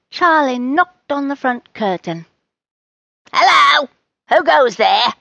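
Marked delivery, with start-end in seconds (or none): 3.3-5.1 loud, raised call to an unseen visitor; shouted challenge. A narrator's reading. Rose calls to whoever knocked on the curtain, shouted. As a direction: call out loudly, demanding who is there. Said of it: "Rose shouted".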